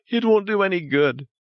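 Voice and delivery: high, breaking woman's voice; tearful refusal. Acted. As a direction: refuse through sobs. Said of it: "Crying like a child".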